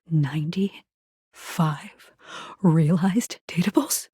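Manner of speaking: slow, low, hushed disbelief; hushed awe. Acted. Marvelling at ninety-five realized dateables, hushed awe. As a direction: drop low and hushed, stretching out the number. Awed at a near-complete count.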